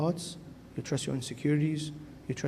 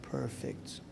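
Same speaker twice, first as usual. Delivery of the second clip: the devil's hushed, satisfied purr, much softer; sly devil; 11 dB quieter than usual. Retold or imitated. Devil gloating softly over a lonely soul. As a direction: murmur it like the devil pleased with himself. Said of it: "he's like".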